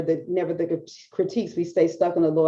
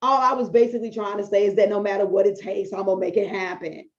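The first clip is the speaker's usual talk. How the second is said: defensive excuse. Retold or imitated. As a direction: brush off the correction, justifying yourself. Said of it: "I could have done one of these".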